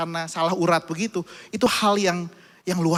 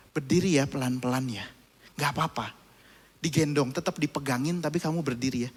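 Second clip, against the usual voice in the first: soft, lower, patient guidance; gentle father; 4 semitones lower, 5 dB quieter than usual. Retold or imitated. Father helps his son stand to pee, soft and patient.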